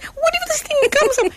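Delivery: high squealing disbelief, half laughing; retold panicked outburst. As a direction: shriek it up high, laughing and wailing at once. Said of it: "I said".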